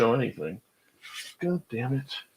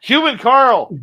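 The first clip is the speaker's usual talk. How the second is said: high, loud, slow herald call; excited announcement; 10 semitones higher, 7 dB louder, slower than usual. Real, not acted. Host hailing a chat regular, high and loud. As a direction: announce him like a star arriving.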